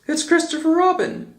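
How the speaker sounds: a high, light little bear voice; Pooh voice. A narrator's reading. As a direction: pitch it up as a simple bear. Pooh's voice in a read story.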